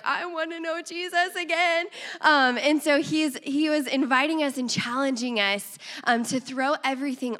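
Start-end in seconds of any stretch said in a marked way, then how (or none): 0.0-1.8 eager longing. Retold or imitated. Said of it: "you're like".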